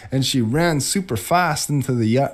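usual speaking voice

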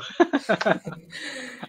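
high-pitched burst of laughter; real, excited giggling laugh; hearty laugh. Real, not acted. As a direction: laugh it out after the hype. Guest laughs after hyping up host.

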